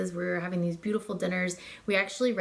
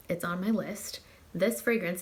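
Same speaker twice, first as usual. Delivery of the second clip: usual speaking voice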